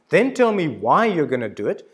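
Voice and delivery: ordinary talk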